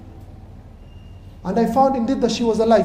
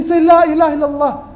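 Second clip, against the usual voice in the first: urgent plea; 5 semitones higher, 6 dB louder than usual. Retold or imitated. Urging a dying man again.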